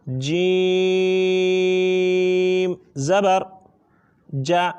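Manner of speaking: جیم held very long, five alifs, before زبر; drawn-out jeem. Shown as a demonstration. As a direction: stretch the vowel of جیم far out. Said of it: "جو جیم کی proper ادائیگی ہے وہ یہ ہے". (جیم کو پانچ الف کے برابر کھینچ کر)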